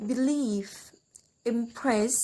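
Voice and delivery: "believe, impress", each weighted on its second syllable; second-syllable stress. Shown as a demonstration. Teacher lists example verbs, stressing the second syllable of "believe" and "impress". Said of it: "stress in the second syllable".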